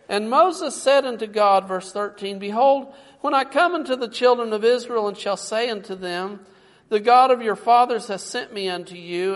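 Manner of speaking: loud preacher proclaiming scripture; booming pulpit reading; preaching. Acted. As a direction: read it out with full force. Preaching scripture at full force.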